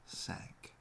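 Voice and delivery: breathy whisper, barely voiced. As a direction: let the word sink into a whisper. Whispered end of a line.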